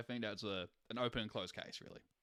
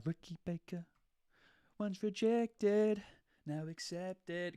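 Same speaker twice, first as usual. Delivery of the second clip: dramatic announcement; 6 semitones higher, slower than usual. Acted. Dramatic verdict on a meme.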